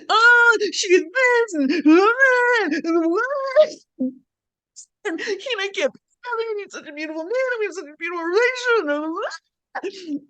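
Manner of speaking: very high, drawn-out sobbing wail; mock crying wail. Venting heartbreak to a partner, hammed-up wailing cry. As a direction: blubber the complaint in exaggerated sobs.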